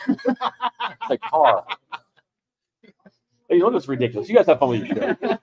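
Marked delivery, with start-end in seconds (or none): 0.1-1.7 "car" stretched very slowly through a laugh; drawn-out "car". Shown as a demonstration. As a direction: drag out "car" with amusement, much slower. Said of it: "it's more of an accent thing".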